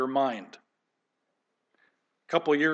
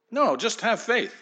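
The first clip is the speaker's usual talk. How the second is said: higher, dismissive "No, just have faith"; mock faith preacher; 7 semitones higher than usual. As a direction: brush off the question like a smug preacher. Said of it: "religious preachers".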